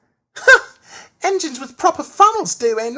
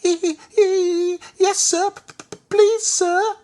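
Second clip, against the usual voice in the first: very high, squeaky, louder stuttering; Percy's nervous stammer; 5 dB louder than usual. A narrator's reading. Percy nervous before the Fat Controller, high pleading stammer. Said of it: "Percy was nervous".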